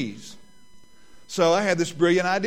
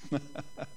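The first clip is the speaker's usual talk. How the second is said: high burst of laughter; 14 dB quieter than usual. Real, not acted.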